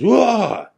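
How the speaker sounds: sudden loud high terrified yell; short high-pitched mock scream; imitated scream. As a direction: jolt awake screaming, as retold. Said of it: "Justin lets out this freaking scream".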